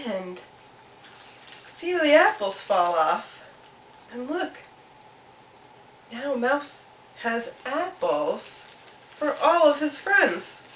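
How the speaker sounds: slow, drawn-out happy ending; storytelling to children. A narrator's reading. Storyteller shows apples for Mouse's friends, slow and pleased. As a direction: stretch each phrase toward the payoff.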